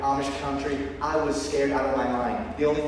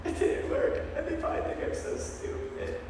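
whispered, softer shame; ashamed whisper; 9 dB quieter than usual. Retold or imitated. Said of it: "I was just like".

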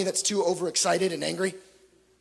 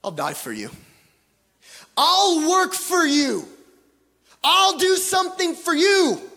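Peter's rising boast; 7 semitones higher than usual. Retold or imitated. Peter's boast before denial.

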